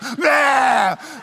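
loud, high "ah" of protest; mock miserable yell. Husband in the rain beside laughing wife, mock miserable yell. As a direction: yell out in hammed-up dismay.

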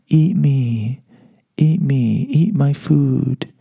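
lightly sung, slow, luring food voice; siren song. Pretend. The food tempting him, a lightly crooned lure. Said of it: "The food sang a mellifluous siren song".

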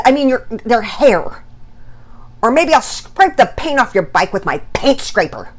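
high, loud, stammering fury; incoherent yelling rant. A narrator's reading. Dad yelling at his kids over broken stuff, nearly incoherent. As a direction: sputter and break off as an acted angry dad. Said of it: "barely speak coherently and was out of breath".

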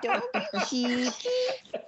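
high, slow, laughing mock Spanish accent. Quoting the ride's pirate line in a laughing mock Spanish accent. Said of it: "I don't know what he had the accent".

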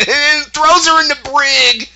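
high, loud, gleeful punchline; excited burst. Retold or imitated. Friend retelling a show to a co-host, high excited punchline. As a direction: blurt the twist out in a big high voice. Laughing retelling of guard's twist.

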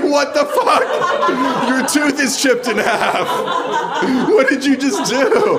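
high, loud laughing yell of disbelief; shocked shout. Retold or imitated. Group shocked at chipped tooth.

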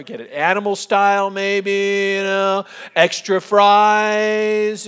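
high, loud, stretched-out food fantasy; dreamy relish. Retold or imitated. Son daydreaming of burgers while dad talks, relishing. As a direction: draw the last words way out, savouring them.

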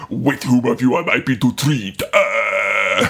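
low, put-on villain voice ending in a groan; Mouth of Sauron. Envoy of Sauron asking whom to treat with, low villain voice. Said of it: "the mouth of Sauron".

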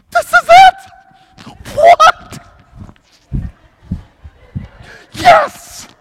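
squealing high and loud, slow and drawn out; a wise man overjoyed at the stopped star; excited. Pretend. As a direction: burst out in giddy disbelief. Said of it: "that was like an excited".

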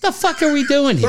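high laughing disbelief; cracking up mid-question. Real, not acted. Friend laughs in disbelief at the airplane story, laughing.

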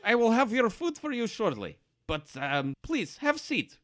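high-pitched bartender voice. Acted. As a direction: play a hospitable innkeeper, pitched well up. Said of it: "the bartender says".

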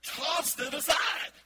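loud, slow, high burst; slow forceful exclamation; emphatic. Shown as a demonstration. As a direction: fling the words out. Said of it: "Literally, and I mean literally".

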